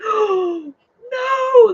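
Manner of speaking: high, loud, wailed dismay; mock horror. Customers reacting to her hitting the spider, mock horror. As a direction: imitate a horrified crowd, high and wailing.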